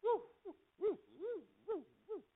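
high, soft "woo" whoops; little high-pitched hoots of excitement; excited wooing. Real, not acted. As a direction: let off steam in small woos. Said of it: "I'm getting excited".

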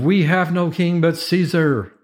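high, slow proclamation; emphatic declaration. A narrator's reading. Priests declaring loyalty before Pilate.

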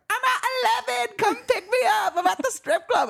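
very high-pitched mocking squeal; hammed-up, squeaky party-girl impression; Brielle. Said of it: "Brielle's like".

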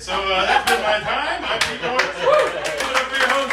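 loud sign-off. Real, not acted. Comic signs off the set.

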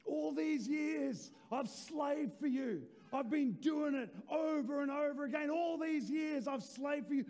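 put-on older brother shouting his grievance to his father; resentful complaint. Preacher voicing the older brother's complaint.

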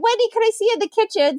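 high, fast mock-stern mom. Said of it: "she's like".

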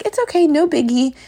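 put-on high breezy kid voice; imitating daughter. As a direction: toss it off like a carefree four-year-old. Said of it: "she's so quick to be like".

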